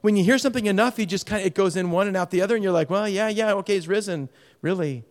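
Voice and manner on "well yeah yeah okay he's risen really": indifferent shrug. Retold or imitated. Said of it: "That indifference".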